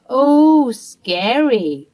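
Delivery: sarcastic high Oh, dropping on scary; mock her threat with a sneer; mock scared. Mother-in-law mocking Mia's threat, sarcastic sneer.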